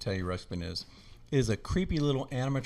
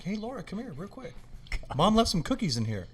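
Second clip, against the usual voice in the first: high, sweetly coaxing fake call; innocent luring; 10 semitones higher than usual. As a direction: lure little sister over in a high put-on voice. Luring his sister to the closet.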